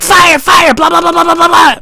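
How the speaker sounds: high, loud shrieking gabble; frantic falsetto shouting; mock panicked rambling. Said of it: "rambled out my address".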